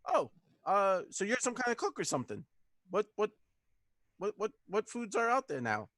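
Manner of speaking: high, tentative questioning with halting repeats; curious character voice. Acted. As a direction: play her curious, pitched well up. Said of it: "she leans over and she goes".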